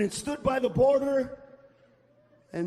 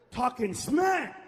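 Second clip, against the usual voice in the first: ordinary talk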